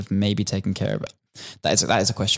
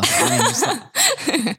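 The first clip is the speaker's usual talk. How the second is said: laughing; much slower than usual. Real, not acted. Laughing at the joke.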